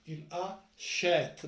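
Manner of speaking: "achète" drawn out slowly with an open è. Shown as a demonstration. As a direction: stretch "Il achète", leaning on the open è of "achète". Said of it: "Donc on prononce le E accent".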